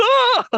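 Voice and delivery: very high, loud comic wail; mock crying wail. Imitating a boy upset over flowers, mock crying wail. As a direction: burst into an exaggerated cartoon cry. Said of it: "Starts crying again".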